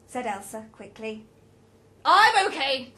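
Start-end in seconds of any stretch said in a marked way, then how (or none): 2.1-2.9 very loud, higher voice; raised, brusque reply; insistent assurance. A narrator's reading. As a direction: insist loudly. Roger insists he is fine.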